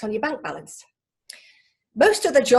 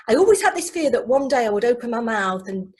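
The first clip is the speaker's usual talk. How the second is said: ordinary talk, nothing marked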